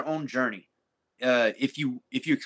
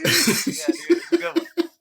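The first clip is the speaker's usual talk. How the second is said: laughing through the words, higher; 4 dB louder, slower than usual. Real, not acted. Laughing with a friend about the video. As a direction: chuckle it out.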